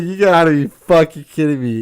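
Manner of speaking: high, loud incredulous outburst; loud disbelief. Retold or imitated. Retelling reaction to anti-vax friend, loud disbelief. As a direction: blurt it out in amused outrage.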